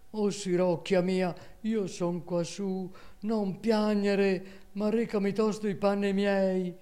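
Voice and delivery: calling loudly. Acted. Lady calls down to her maid from the tower, high and loud. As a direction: strain to make yourself heard. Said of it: "come più forte poté".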